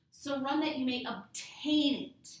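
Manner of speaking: OBTAIN punched loud on its second syllable; stress on OBTAIN. Shown as a demonstration. Teacher shows holding on, stressing OBTAIN. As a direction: hit the "tain" of "obtain" hard. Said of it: "if you're emphasizing that obtain".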